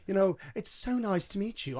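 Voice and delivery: lightly put-on British accent; a hint of a weird, wobbly British accent. Date suddenly turns British across the coffee table, imitated British accent. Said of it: "brings on this weird British, British accent".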